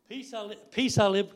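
high, loud proclamation; scripture reading. Real, not acted. Preacher starting a Bible verse for the congregation, loud reading. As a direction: project the verse to the whole room.

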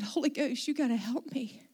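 soft, retold prayer for help; quiet plea. Pastor with buckled knees asking for help, softly. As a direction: plead softly to yourself.

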